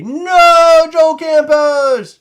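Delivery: loud, high, stretched cry of a name; excited shout-out. Real, not acted. Host cheering a buyer's name, excited and drawn out. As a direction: call the name out big and slow.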